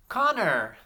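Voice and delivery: Connor high and loud, in one piece; high, loud Connor with a falling end; name called out. Shown as a demonstration. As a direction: call out Connor whole for the class to echo. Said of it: "say this name with me".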